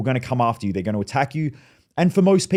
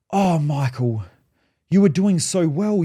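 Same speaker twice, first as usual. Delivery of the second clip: disappointed mum; 4 semitones higher, slower than usual. Retold or imitated.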